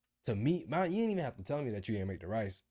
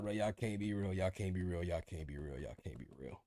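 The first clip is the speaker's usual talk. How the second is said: repeated, dropping lower and softer each time; fading disbelief; 4 semitones lower, 6 dB quieter than usual. Retold or imitated. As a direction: let it die away in disbelief. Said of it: "I was just like".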